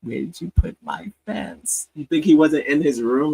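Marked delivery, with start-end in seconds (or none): 0.0-1.9 Howard. Retold or imitated. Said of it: "think Howard didn't talk like this".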